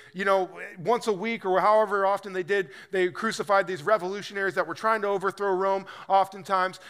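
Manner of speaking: ordinary talk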